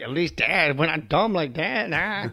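high, snarky little dog voice; the dog mocking dad; trash-talking dog. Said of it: "constant, like, shit talking".

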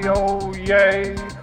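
ordinary talk

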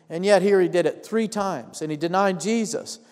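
ordinary talk

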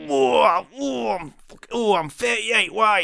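high, loud, slow hammed-up moans of pleasure; pleasured moaning. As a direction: ham up a man enjoying it far too much. Truck driver answering mid-act.

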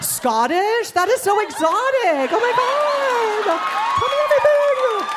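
high, gushing, overexcited squeal; gushing fascinated voice. Retold or imitated. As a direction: fawn over it like it's exotic. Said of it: "be like".

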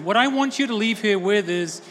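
usual speaking voice

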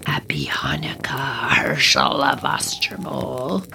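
low, whispered menacing greeting; eerie goblin king whisper. Acted. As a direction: creep into the room with a low whisper. Goblin king speaks in story.

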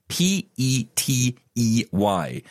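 slow letter-by-letter spelling; carefully spelled letters; spelled out slowly. Shown as a demonstration. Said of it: "I hope my horrible accent makes sense of that".

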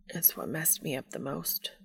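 hushed, strained confession; subdued and pained. A narrator's reading. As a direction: confess it very softly, under strain. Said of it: "His voice was getting more strained".

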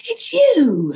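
lightly voiced amazed fan; starstruck fan. Imitating a fan who finally reaches her, amazed. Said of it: "runs up and goes".

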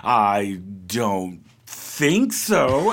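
low, very slow mock of an evasive denial; mock Trump. Imitating Trump's slow dodge of a reporter's question, low and drawn out. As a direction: drag it out like a guilty kid dodging. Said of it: "and he's like".

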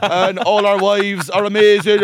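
booming announcer voice, high and loud; bellowing into a microphone. Retold or imitated. Mimicking the Cork mayor booming at a launch, bellowing. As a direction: bellow it like a mayor at Croke Park. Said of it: "the way they talk into the microphone at Croke Park".

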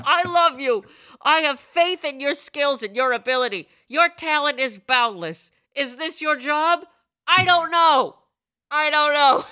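lightly voiced adoring wife; mock gushing support. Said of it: "And I'm like".

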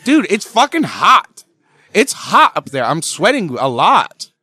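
high, loud protest; real laughing-defensive outburst; loud defensive denial. Real, not acted. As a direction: insist loudly it's only sweat. Denying crying, defensive.

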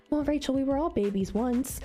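light Phoebe voice; Phoebe impression. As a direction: quote Phoebe airily. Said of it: "remember when Phoebe said".

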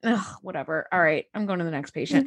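imitating an irritated medic with a mild scoff; mock annoyed dismissal. Impersonating the annoyed, uncaring medic.

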